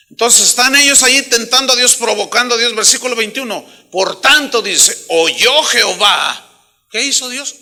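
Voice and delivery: loud, driving sermon voice; full-force preaching. Acted. Pastor thunders at the congregation, full-force preaching. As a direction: preach it to the back row.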